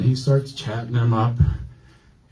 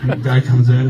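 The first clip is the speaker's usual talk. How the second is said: ordinary delivery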